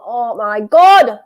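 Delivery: long drawn-out, loud exclamation; astonished exclamation. Real, not acted. Football fan watching a brawl break out, loud astonished drawl. Fan reacting to players fighting.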